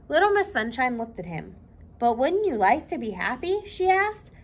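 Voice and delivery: lively read-aloud for children, voicing each character; storybook reading. A narrator's reading. Librarian reading Little Miss Sunshine to children, lively storytelling.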